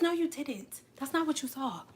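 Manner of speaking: soft, unruffled mock denial; calm gaslighting. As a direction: contradict quietly and coolly. Acted Scorpio calmly denying.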